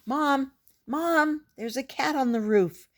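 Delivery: put-on high, loud excited child calling Mom; little girl calling her mother, excited and loud. A narrator's reading. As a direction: shout up to Mom about the cat on the roof. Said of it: "I called".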